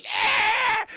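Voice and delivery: high, loud outburst yell; Whitey losing it. Acted. Whitey losing it over a crude remark, high yell. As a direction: let out a sudden high cartoon yell. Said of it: "fucking Whitey just loses".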